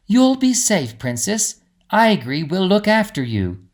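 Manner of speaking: lightly voiced fairy, reassuring; reassuring Greta. A narrator's reading. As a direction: comfort the princess before leaving.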